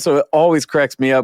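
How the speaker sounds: usual speaking voice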